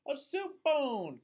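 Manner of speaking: put-on high, slow delighted cry of an old lady; excited cry. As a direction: cry out with joy at the find. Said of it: "cried the little old lady".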